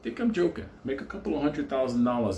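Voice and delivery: ordinary delivery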